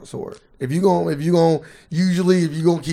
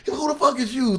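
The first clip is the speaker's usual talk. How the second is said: pitched-up mock insult; mock roast jab; 8 semitones higher, 5 dB quieter than usual. Comic roasting a guest, mock insult. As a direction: throw it like a roast line, higher.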